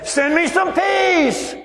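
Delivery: high, slow hammed-up shout; mock desperate plea. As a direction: cry it out to the heavens, overdone. Mock gardener begging again.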